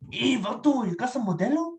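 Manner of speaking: high-pitched Chicano caricature; Chicano accent. Acted. Impressionist's last accent for a stranger, high Chicano voice.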